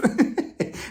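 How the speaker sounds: short high laugh; amused snicker; quick laugh. Real, not acted. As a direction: chuckle at her nerves. Laughing at nervous tattoo.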